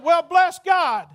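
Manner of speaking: mock-proud shout; mock exclamation. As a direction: drag it out in triumph. Retold exclamation at the desk.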